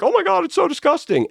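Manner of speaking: high, whiny mock outrage; mocking disgust. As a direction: squeal it like a scandalised prude. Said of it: "they're like".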